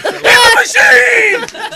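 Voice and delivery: high, slow, laughing yell; mock Russian cheer, shouted; gleeful shout. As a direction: belt it like drunk partygoers. Gangsters shout back his phrase.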